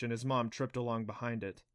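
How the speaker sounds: ordinary delivery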